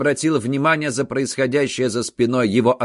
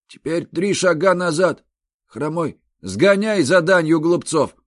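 thug voice; 8 semitones higher than usual. A narrator's reading. Thug giving orders.

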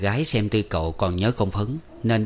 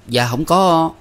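much higher, louder protest; quick protest; 8 semitones higher, 5 dB louder than usual. A narrator's reading. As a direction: fire back in denial. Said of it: "Tư Cầu cãi liền".

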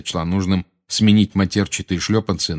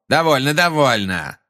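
raised, dismissive interruption; scornful exclamation; 7 semitones higher, 3 dB louder than usual. A narrator's reading. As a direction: cut the witness off with scorn. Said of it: "воскликнул Фадж с чрезвычайно презрительным видом".

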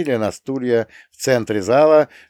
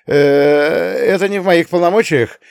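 flustered official; 5 semitones higher, 5 dB louder than usual. A narrator's reading. Flustered mayor excuses himself.

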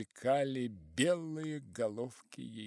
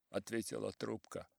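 ordinary delivery

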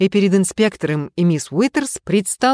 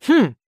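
short, higher-pitched doubting "hm"; unconvinced grunt, pitched up; sceptical hmm; 7 semitones higher than usual. A narrator's reading. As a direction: give a disbelieving little hum. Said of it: "недоверчиво хмыкнул инспектор".

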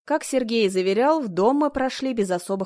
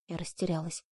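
ordinary delivery, nothing marked

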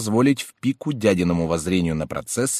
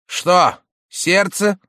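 uncle voice; 11 semitones higher, 7 dB louder, much slower than usual. A narrator's reading. Uncle asks maid.

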